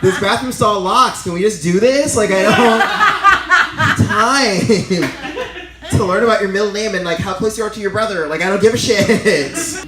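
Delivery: loud, laughing, impatient rant. Retold or imitated. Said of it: "And I'm like".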